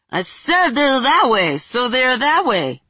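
slow, muffled, face down. Acted. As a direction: talk into the ground, muffled. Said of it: "with a muffled voice".

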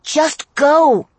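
sharp, loud command to leave; urgent shout. Acted. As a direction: yell it out urgently. Character urging departure.

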